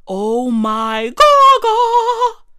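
very high, slow delighted gasp; hammed-up high swoon of delight; gushing. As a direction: swoon over it in a high drawn-out squeal. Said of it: "my reaction was simply".

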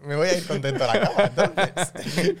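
laughing. Real, not acted. Joking, laughing.

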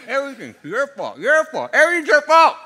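rising from plain to high loud accusation; building blame. Retold or imitated. The enemy whispering blame about the relationship, building to a shout. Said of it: "It's like".

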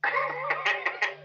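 a sudden high squeal of a laugh; cracking up at the joke; burst of high laughter. Real, not acted.

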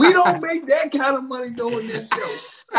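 laughing through the words. Real, not acted. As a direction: complain while cracking up. Laughing while complaining.

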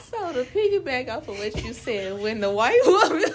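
high, loud burst of laughter; laughing. Real, not acted. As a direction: crack up mid-sentence. Bursts laughing starting her point.

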